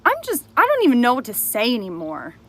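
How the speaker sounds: loud, high, furious confrontation; raging at someone who betrayed you; angry shouting. Real, not acted. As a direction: yell it right at the liar.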